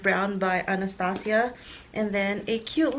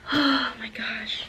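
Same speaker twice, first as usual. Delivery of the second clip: awed whisper; 3 dB quieter than usual. Real, not acted. Awed at the bag.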